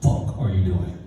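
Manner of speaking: low, contained retold anger; lightly voiced exasperated stepdad; furious stepdad. Stepdad scolding the boy at the car, restrained fury. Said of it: "of course he's furious".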